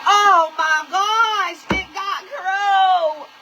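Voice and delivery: loud, drawn-out retold wail; mock horrified cry. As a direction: stretch each word in exaggerated dismay. Retold horror at finding feathers.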